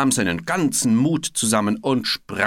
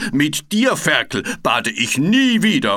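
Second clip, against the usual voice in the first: louder, furious bellow; angry roar; 6 semitones higher, 7 dB louder than usual. A narrator's reading. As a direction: bellow your outrage. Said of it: "brüllte Frederik".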